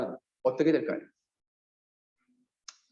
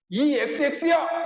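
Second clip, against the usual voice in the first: a hint of a cursing politician, higher; mock curse; 6 semitones higher than usual. Pastor imitates the president cursing, lightly voiced. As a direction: spit out a bleeped insult. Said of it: "윤 대통령처럼".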